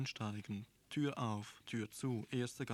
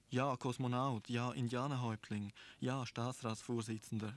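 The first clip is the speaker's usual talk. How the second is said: ordinary delivery, nothing marked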